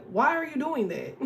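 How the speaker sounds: usual speaking voice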